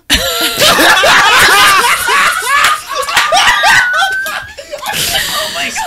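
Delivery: long, high shrieking laugh; real helpless laughing fit; hysterical laughter. Real, not acted. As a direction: lose it completely, laughing at full pitch. Cracks up at the language joke.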